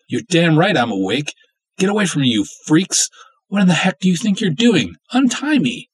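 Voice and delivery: high, loud, outraged shouting; furious yelling. Acted. Captive woman shouting at the freaks holding her, outraged. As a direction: demand they untie you, furious.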